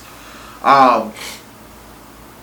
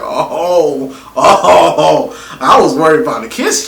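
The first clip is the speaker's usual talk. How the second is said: laughing disbelief; 6 dB louder, slower than usual. Retold or imitated. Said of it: "I'm like".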